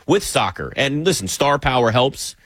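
usual speaking voice

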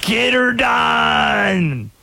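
slow, shouted Southern drawl; Southern accent. Retold or imitated. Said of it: "It's always the Southern accent".

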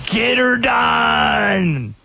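high, drawn-out Southern holler; hammed-up Southern accent, stretched long. Host imitates Larry the Cable Guy's catchphrase, in a Southern accent. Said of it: "It's always the Southern accent".

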